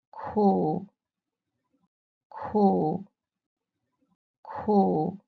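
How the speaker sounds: teacher modelling "Ku" on a low dipping tone; Mandarin dipping tone. Shown as a demonstration. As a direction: drop "Ku" down low, then lift it slightly. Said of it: "How do you say bitter".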